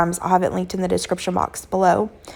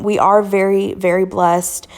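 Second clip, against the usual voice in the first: usual speaking voice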